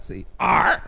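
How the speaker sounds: pirate arr. Shown as a demonstration. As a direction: pitch the arr up to present it. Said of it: "un autre mot de pirate".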